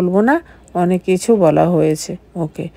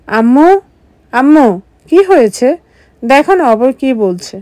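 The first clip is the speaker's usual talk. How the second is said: louder, higher call for mom; 5 semitones higher, 4 dB louder than usual. Acted. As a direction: call mom across the house. Calling mother to complain. (জোরে আম্মুকে ডাকা)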